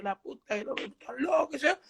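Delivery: high-pitched retold panic; acted nervous cursing. Rattled Freddy after the prank, acted nervous cursing. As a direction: curse in a high, flustered voice. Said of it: "Freddy estaba tan nervioso y dice".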